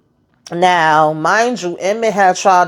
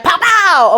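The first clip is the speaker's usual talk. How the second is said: mock gunshots; 11 semitones higher, 3 dB louder than usual. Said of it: "like my girl did in the Five Heartbeats".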